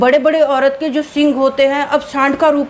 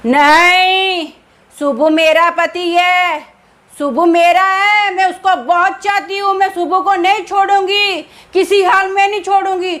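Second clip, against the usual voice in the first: possessive ghost; 5 semitones higher than usual. A narrator's reading. Evil spirit refuses to leave.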